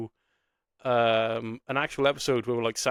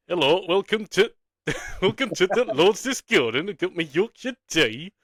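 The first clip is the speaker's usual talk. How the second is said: hammed-up, higher-pitched Northern English accent; Yorkshire accent; 7 semitones higher than usual. Host playing at a Yorkshire tea advert, mock Yorkshire accent. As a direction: put on a broad mock Yorkshire voice. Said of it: "I can't do a Northern accent".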